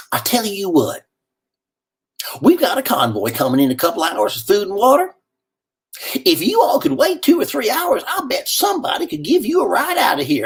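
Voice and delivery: high, kindly offer of a ride; folksy volunteer lady. Retold or imitated. Red Cross lady promising the stranded man a ride, high folksy voice.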